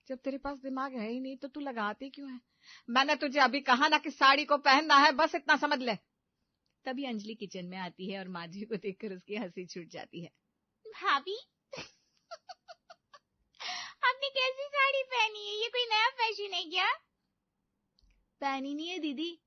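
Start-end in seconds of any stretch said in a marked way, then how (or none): 2.9-6.0 loud scolding. Acted. Mother-in-law losing temper. 10.9-16.9 amused. Acted. Sister-in-law laughing at saree.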